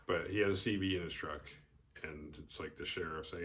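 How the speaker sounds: ordinary talk, nothing marked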